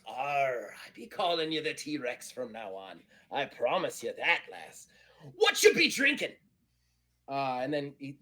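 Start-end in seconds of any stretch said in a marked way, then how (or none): 0.1-6.3 pirate growl of "Arr", swaggering; pirate Eddie Teach. Acted. Pirate naming a crewmate, then asking about drinks, swaggering. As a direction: promise like a pirate, then holler the question.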